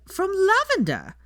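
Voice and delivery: loud, high, slow scoff. A narrator's reading. Hermione scoffs at Draco's suggestion, loud and high. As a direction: spit the name out in disbelief. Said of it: "Hermione scoffed".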